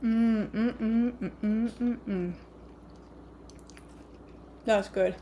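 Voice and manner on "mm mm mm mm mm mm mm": repeated mm of pleasure; savoring hums. Real, not acted. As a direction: hum with each bite. Savoring food.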